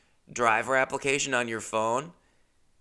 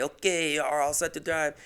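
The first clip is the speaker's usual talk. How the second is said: higher-pitched mock French voice; French accent; 4 semitones higher than usual. French company man emailing the go-ahead, mock French accent. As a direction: imitate a Frenchman with a thick accent. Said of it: "when you have a French accent".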